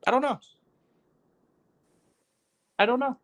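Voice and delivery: high, squeaky "I don't know"; high-pitched helplessness. Real, not acted. Host at a loss how to say it, high-pitched helplessness. Comic helplessness after listing languages.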